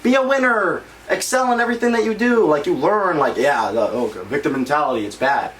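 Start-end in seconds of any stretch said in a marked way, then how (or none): 0.0-2.5 higher, chirpy slogan read; imitating corny motivational wall posters; mock poster slogan. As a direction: sing it like a cheesy classroom poster. Teacher's motivational poster quoted.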